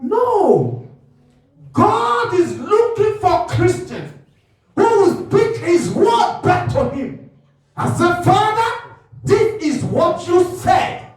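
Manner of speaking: loud, high, slowed sermon cry; fiery preaching. Real, not acted. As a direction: preach it out, declaring to the Father. Preaching at full force.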